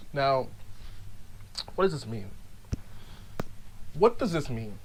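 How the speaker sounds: low, hushed question; solemn, quiet musing; grave pondering. Acted. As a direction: ponder it softly and deeply.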